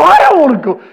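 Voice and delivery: high, loud mock wail of protest; comic protest. Preacher regretting he agreed to go, comic protest. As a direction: balk loudly, hammed up. Retold reluctance to attend gig.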